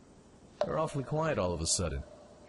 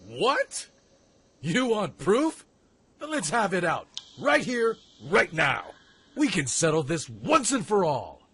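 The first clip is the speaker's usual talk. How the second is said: louder and higher, daring him to fight; angry challenge; 5 semitones higher, 6 dB louder than usual. Acted. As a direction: square up and call him out. Rival answers the accusation.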